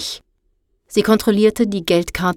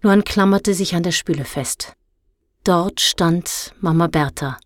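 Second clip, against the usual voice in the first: ordinary delivery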